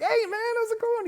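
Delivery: very high, loud carefree greeting. Retold or imitated. Drummer arriving after the fiasco, comically carefree. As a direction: chirp it as if nothing happened, caricatured. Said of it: "He's totally fine".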